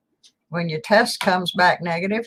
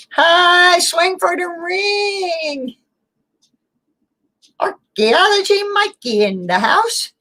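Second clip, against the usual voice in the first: excited greeting; 11 semitones higher, slower than usual. Real, not acted. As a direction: call out a warm welcome.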